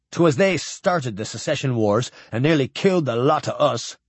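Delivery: ordinary delivery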